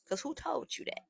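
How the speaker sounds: mock innocent denial. Feigning innocence to boyfriend.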